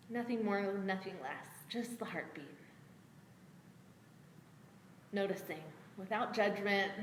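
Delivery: soft, low, hushed meditation voice; guided meditation. Acted. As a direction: guide them inward quietly, with long pauses. Guides attention to the heartbeat.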